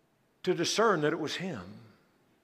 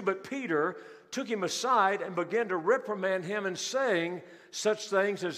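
normal talking voice

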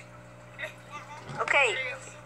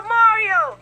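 rally cry; 6 semitones higher, 3 dB louder, slower than usual. Pretend. Rallying crowd call.

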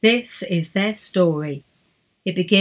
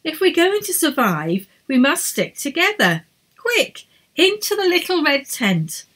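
urgent leader; 8 semitones higher than usual. A narrator's reading. Edith urges shelter.

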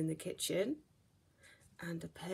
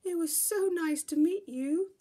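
much higher, louder hooting owl; 8 semitones higher, 7 dB louder than usual. Acted. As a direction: hoot it like a friendly owl. Owl says goodbye.

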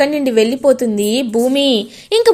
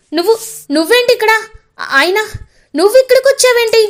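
higher, fast, startled question; surprised; 9 semitones higher, faster than usual. Acted. Cherry spotting his brother unexpectedly, startled and fast. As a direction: blurt it out, caught off guard.

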